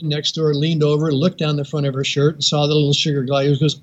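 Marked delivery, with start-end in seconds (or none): none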